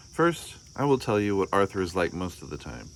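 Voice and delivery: plain reading voice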